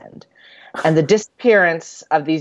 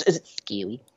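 hushed whispered question; playful whisper; 8 semitones lower, 8 dB quieter than usual. Pretend. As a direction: whisper it like a spooky aside. Playfully quizzing imagined Australian listeners.

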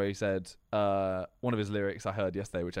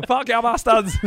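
very loud, high mock battle cry; gleeful rampage yell; 12 semitones higher, 12 dB louder than usual. Imitating a streamer setting everything on fire, high loud yell. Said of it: "started lighting everything on fire, going".

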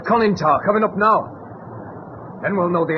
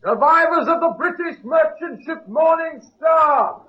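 shouting across; 6 semitones higher than usual. Acted. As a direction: call out to be heard on the sub. Hailing the submarine across water.

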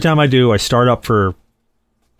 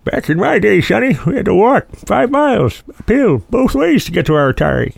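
creaky grandpa lecturing Sonny, high; old man voice; 10 semitones higher than usual. Acted. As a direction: play a grumbling old-timer. Grumpy grandpa 'Sonny' bit.

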